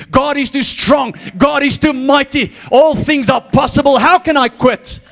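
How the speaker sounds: rally the church, shouting it; full-force preaching. Acted.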